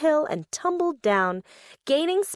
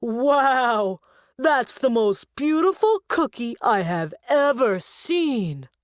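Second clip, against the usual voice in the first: amazed. Acted. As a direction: marvel at the cookie. Muggo sees the cookie.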